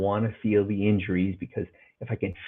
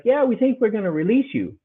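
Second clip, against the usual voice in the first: a lightly voiced breezy doctor, pitched higher; mocking the doctor who'd send him home; 10 semitones higher than usual. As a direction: play the casual doctor higher.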